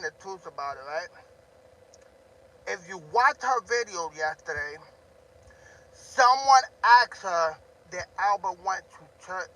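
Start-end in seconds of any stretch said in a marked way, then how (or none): none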